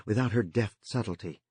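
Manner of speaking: plain reading voice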